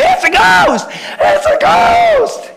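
very high, hammed-up terrified shriek; cry of fear. Superstitious disciples seeing a ghost, shrieking in mock fear. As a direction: shout it in mock panic, drawing out the last word. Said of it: "cried out in fear".

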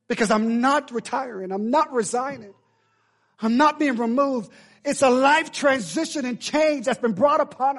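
high, loud, drawn-out declarations; impassioned sermon climax at full voice; full-force preaching. Real, not acted. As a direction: proclaim it to the whole church.

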